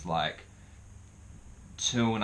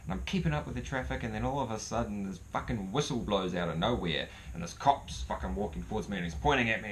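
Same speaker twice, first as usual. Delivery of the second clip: ordinary talk, nothing marked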